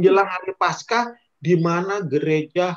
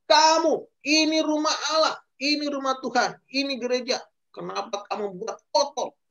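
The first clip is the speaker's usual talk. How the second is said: angry rebuke; 6 semitones higher than usual. Acted. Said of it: "Tuhan Yesus marah".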